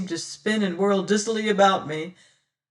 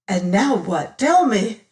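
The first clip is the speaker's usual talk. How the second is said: louder, rising to a shout; insistent demand; 3 semitones higher, 7 dB louder than usual. Acted. Martin insists Damaris answer, louder and rising. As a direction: hammer the demand home.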